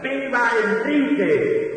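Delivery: high, slow triumphant cheer; jubilant cry. Acted. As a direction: ring it out like a victorious crowd. Victors shouting for Enrique.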